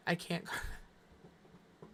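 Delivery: slow, breathless near-whisper as the voice fails; letting the word die off with no voice; voice giving out. Real, not acted. Streamer's voice fails mid-sentence, a slow breathless near-whisper.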